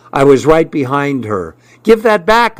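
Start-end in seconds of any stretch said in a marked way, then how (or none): 1.8-2.6 high-pitched, mild holler. A narrator's reading. Boy chasing the girl for his diary, hollering. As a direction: call out urgently, pitched high. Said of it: "I hollered".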